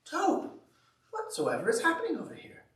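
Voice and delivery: high, quick startled call; alarmed Frog. Acted. Frog rushing over to Toad's noise, high and quick. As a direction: rush in asking what's going on.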